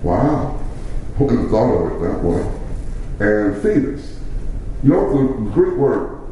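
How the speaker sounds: ordinary delivery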